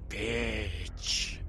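low, harsh rasp, spat in the face. A narrator's reading. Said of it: "she rasped".

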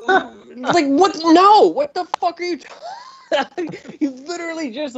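what-are-you-talking-about outrage, rising high; incredulous. Real, not acted. Incredulous reaction to movie.

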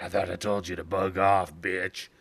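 low channelled voice, unlike one's own. Retold or imitated. Demon channelling itself through a man's head, low channelled voice. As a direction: speak as someone talking through a possessed head. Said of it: "start just channeling, talking through his head".

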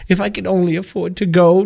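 usual speaking voice

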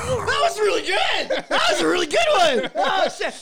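talking through high laughter; words squeezed out mid-laugh; real giggly delight. Real, not acted. Still laughing after the sound.

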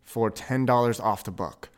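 normal talking voice

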